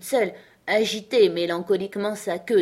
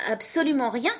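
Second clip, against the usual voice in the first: higher voice, lifting on "rien"; high reply; 7 semitones higher than usual. A narrator's reading. Alice answering the king's question, high-voiced.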